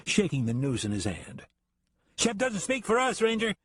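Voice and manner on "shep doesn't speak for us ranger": high voice calling from afar. Acted. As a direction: call out over the crowd. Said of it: "a man called out".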